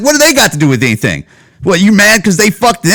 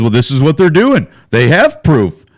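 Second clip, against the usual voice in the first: lightly put-on naysayer voice; mimicked objector; 2 semitones lower than usual. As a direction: parrot the objection in a lowered voice. Mimics listeners' objection in argument.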